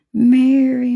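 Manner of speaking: name sung out high and loud; excited call. Real, not acted. Painter calls out to viewer Mary, excited. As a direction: call out to a friend.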